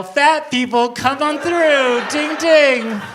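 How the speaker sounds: loud, drawn-out carnival barker call; hammed-up fairground barker; carny calling out. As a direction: bark it like a carny ringing a bell. Carny ringing bell, calling out.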